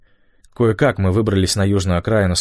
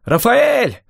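high-pitched urgent call across distance; yelling; 5 dB louder, much slower than usual. A narrator's reading. Waving and calling Rafael over to the road, yelling. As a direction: yell for him to come over. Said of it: "орал я".

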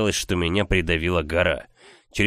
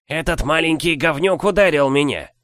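squeaky indignant giant; 7 semitones higher, 4 dB louder than usual. Acted.

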